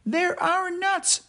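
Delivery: high, slow squirrel protest; exclaiming squirrel. Acted. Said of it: "exclaimed Bruce".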